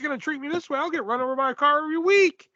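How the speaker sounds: high, quick pretend outburst; joking exclamation. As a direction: pitch it way up and rattle it off in mock excitement. Listener jokingly voices the teller.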